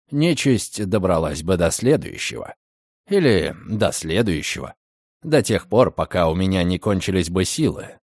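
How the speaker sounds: low calm male voice. A narrator's reading. Redfern explains coldly. (низкий спокойный голос)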